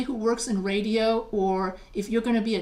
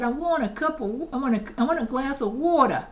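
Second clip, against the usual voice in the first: regional New York speech, a little higher; New York accent; 4 semitones higher than usual. Retold or imitated. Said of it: "I spoke like that without realizing that I was, sounded so regional".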